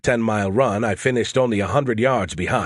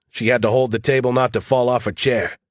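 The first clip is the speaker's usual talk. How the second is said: plain reading voice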